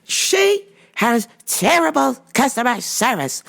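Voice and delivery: hammed-up indignant complainer; indignant customer. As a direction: draw out an overblown customer complaint. Acting out an irate customer's complaint.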